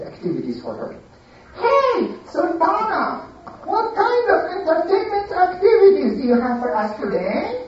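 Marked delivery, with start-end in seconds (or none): 1.6-7.6 high, bored, demanding; spoiled lady. Acted. Lady asking Sultana for entertainment, high and demanding. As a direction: call for your entertainer like a bored queen.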